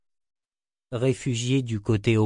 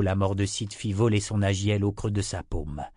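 usual speaking voice

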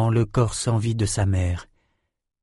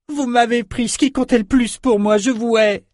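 put-on high-pitched hateful young girl; furious outburst; 13 semitones higher than usual. A narrator's reading. Orphaned Samantha refuses all pleas, high furious outburst. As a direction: hurl the hatred in a very high voice. Girl repeats her hatred, enraged.

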